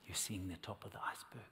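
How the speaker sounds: hushed whisper for the voice of God. Retold or imitated. Recalling God's word to him, in a whisper. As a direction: breathe it, barely voiced. God's words retold to the preacher.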